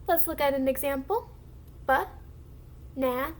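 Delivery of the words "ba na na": Ba-na-na in three separate beats, long gaps; syllable by syllable. Shown as a demonstration. Teacher shows blending to the class, syllable by syllable. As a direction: break Ba-na-na into slow, isolated syllables. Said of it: "we are blending into three syllables".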